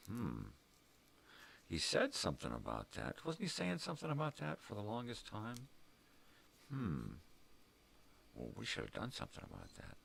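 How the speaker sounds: pondering, pitched voices of others; musing bystanders. Retold or imitated. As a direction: muse over it like gossiping onlookers. Voicing others musing later.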